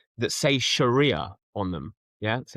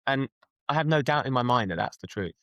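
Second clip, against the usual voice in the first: ordinary talk, nothing marked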